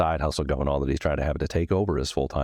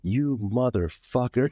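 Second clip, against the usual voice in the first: 1 irate customer cursing; 9 semitones higher, slower than usual. Retold or imitated. Angry customer cussing him out.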